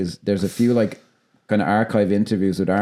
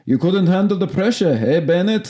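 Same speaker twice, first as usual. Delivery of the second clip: badly faked Arnie accent, pitched up; terrible Arnie accent; 8 semitones higher than usual. Podcaster recalling a cut one-liner, in a bad higher Arnie accent. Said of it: "that was a terrible accent".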